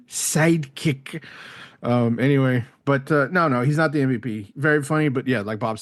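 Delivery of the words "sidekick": higher, very slow, stretched-out word; exaggerated put-on voice. Shown as a demonstration. Guest mocking his own slip, in a hammed-up drawn-out voice. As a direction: ham up the silly voice, drawing it right out. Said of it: "that voice".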